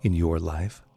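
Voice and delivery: calm, unhurried meditation voice with long pauses; guided meditation. Acted. Teacher guiding listeners to relax, calm meditation voice.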